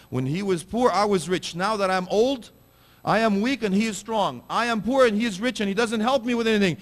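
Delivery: put-on high, loud, aggrieved old father's complaint; pleading old father.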